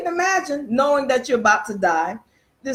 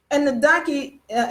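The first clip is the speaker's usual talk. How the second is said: ordinary delivery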